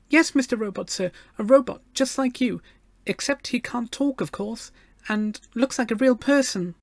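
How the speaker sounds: put-on high, earnest little girl; treble child voice. A narrator's reading. Gloria describes her robot friend to the machine, earnest treble. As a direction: explain it in a small, high, polite voice. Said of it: "she raised her treble voice".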